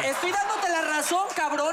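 réplica aguda y exaltada; defiéndete gritando, más agudo; protesta a gritos. Real, not acted. Responde al regaño del compañero. (grito de reclamo al compañero)

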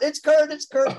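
high, loud imitation of panicked finger-pointing; mock-frantic blaming. Imitating a friend pointing at Kurt to escape blame, mock-frantic. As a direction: shift the blame in a high yelp.

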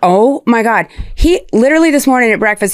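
ordinary delivery

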